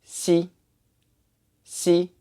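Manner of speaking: "si" as a thin hiss between s and sh; tongue behind bottom teeth on "si"; high, level "si" with a Chinese x; Chinese X sound. Shown as a demonstration. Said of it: "tongue goes under your bottom teeth".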